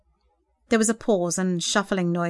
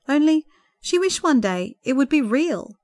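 ordinary delivery

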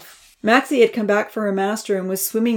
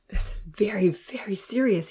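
whispered thought; 5 dB quieter than usual. A narrator's reading. Uncle Louis thinking to himself in a worried whisper. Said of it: "he thought to himself".

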